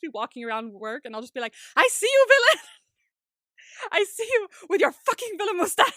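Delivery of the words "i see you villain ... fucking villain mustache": high, very loud mock accusation; melodramatic shout. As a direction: yell it like an over-the-top soap hero. Quoting favorite line, acted outburst.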